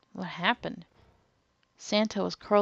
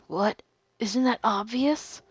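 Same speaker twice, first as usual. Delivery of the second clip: cool, slow, unbothered reply; calm smug reply; 3 semitones higher, 3 dB louder than usual. Acted. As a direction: say it calmly as if it's obvious. Ace answers calmly.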